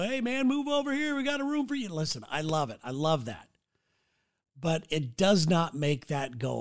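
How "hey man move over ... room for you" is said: imitating a kind viewer, higher and upbeat; friendly invite. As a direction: playfully quote the caring fans offering a room. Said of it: "will reach out to me and go".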